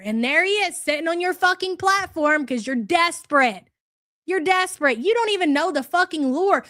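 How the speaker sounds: ordinary talk, nothing marked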